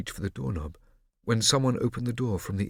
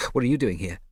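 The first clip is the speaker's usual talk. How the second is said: louder, higher, biting retort; spat back; 3 semitones higher, 6 dB louder than usual. A narrator's reading. Scipio snapping back at Mosca, loud and spat. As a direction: throw the same words back hard. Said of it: "Scipio spat back".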